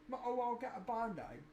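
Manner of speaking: put-on echoing the line in a British accent. Sibling echoes the Band-Aid line in a light British accent. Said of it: "I said it in a British accent".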